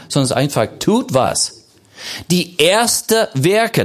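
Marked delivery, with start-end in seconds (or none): 2.3-3.8 slow, higher, emphatic "erste Werke"; stress on erste Werke. Shown as a demonstration. Preacher correcting where the emphasis lies, stressing "erste Werke". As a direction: punch "erste" and draw it out, then land "Werke". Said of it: "das ist die Betonung".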